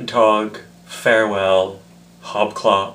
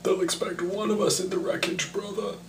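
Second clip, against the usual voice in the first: higher, softer, muffled villain line; a light try at the masked villain; Bane impression; 9 semitones higher, 7 dB quieter than usual. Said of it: "I can do Bane".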